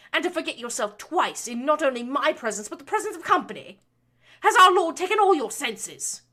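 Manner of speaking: loud, high, rising fury; lashing out. A narrator's reading. Baroness lashing her son for lecturing her. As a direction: build the tirade to a shrieking peak. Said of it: "between her lashings".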